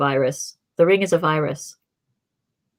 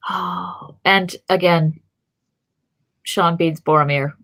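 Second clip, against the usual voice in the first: usual speaking voice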